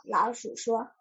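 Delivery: ordinary delivery, nothing marked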